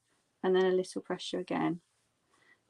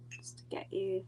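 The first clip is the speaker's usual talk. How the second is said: whispering; 11 dB quieter than usual. Real, not acted. Whispered aside mid sentence.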